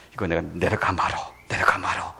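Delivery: low whispered grumble to himself; muttered hesitation. Retold or imitated. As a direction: half-whisper it, torn whether to come down. (예수님이 혼잣말로 내려갈까 망설이며 낮게 속삭이는 말투)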